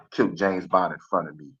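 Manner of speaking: ordinary talk, nothing marked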